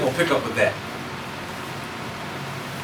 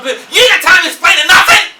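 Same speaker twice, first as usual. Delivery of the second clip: high, loud burst of impatience; urgent shout; 13 semitones higher, 9 dB louder than usual. Acted. Preacher to congregation on a child in danger, urgent shout. Cutting off an acted lecture, urgent.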